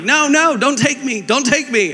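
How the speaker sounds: high-pitched imitation of panicked kids; mock child's screaming. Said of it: "they're screaming, and they're like".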